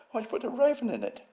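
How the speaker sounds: very high, fast sneering protest; David Wilson. Retold or imitated. Museum head objecting to the raven, imitated in a high sneer. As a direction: imitate a scornful grandee, squeaking with disbelief. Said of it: "he is a sneerer".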